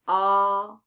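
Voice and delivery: "a" held alone, loud and drawn out; isolated vowel sound. Shown as a demonstration. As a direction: sustain "a" as a bare vowel, loudly. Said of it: "two vowel sounds".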